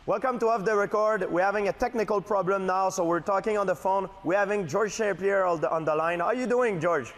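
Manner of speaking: high-pitched mock show host; Michael Landsberg. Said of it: "I wanna be you".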